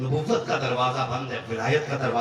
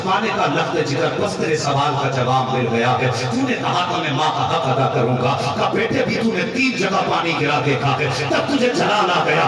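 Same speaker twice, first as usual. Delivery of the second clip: loud, high, impassioned sermon delivery; full-force preaching; 6 semitones higher, 9 dB louder than usual. Acted. Preacher builds the mother's rebuke into a climax, impassioned. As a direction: preach it at the top of your voice.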